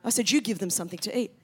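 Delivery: a weary God brushing off excuses; God, eye-rolling. Retold or imitated. God answering the speaker's excuses, exasperated and low. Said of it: "God's kind of rolling his eyes".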